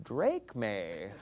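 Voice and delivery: "Drachme" low and soft, rising, as drake-may; mocked misreading. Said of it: "he's looking at it like".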